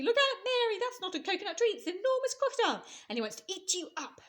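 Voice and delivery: hippo yelling a warning as he charges; shout. Acted. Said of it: "shouted Humpy Rumpy".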